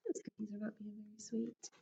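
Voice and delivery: soft whispered aside. Acted. Teacher whispering an aside to the class. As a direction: lean in and whisper it to the kids.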